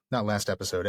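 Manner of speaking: ordinary delivery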